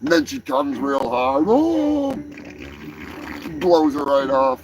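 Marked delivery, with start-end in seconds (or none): 1.5-2.1 mock orgasm cry. Woman climaxing in crude joke.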